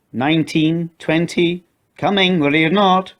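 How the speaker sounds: put-on child counting. A narrator's reading. Katie ends hide and seek count, slow sing-song call.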